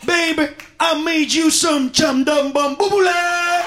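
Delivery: high, slow put-on grandma calling out; mock grandma gibberish. Grandma calling grandson to eat, high slow mock voice. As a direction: sing it out like grandma from the kitchen. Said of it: "Grandma doesn't come out of the kitchen talk about".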